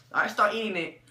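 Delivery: ordinary talk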